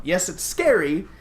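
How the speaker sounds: usual speaking voice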